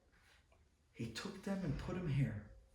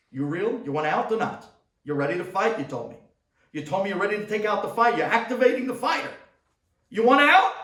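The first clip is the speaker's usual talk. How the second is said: higher and higher, louder and louder shout; coaching yell; 7 semitones higher, 17 dB louder, faster than usual. Retold or imitated. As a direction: fire him up like a coach. Coach yelling to rouse boy.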